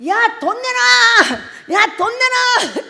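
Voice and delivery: high, loud, drawn-out shout; retold yelling. Preacher imitating someone yelling at a rich elder, mock shout. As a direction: yell a slow, hammed-up demand, stretching the last syllable. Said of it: "이러고 소리를 지르는 거예요".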